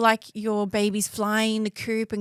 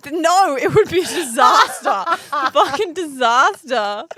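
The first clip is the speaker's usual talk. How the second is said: laughing; 2 semitones higher, 6 dB louder than usual. Real, not acted. Laughing insistence.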